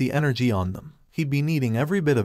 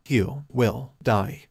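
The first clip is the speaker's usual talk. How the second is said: slow, cold, each word dropped like poison; slow venomous threat; much slower than usual. A narrator's reading. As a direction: hold each word, let the menace drip. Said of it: "his words dripped with venom".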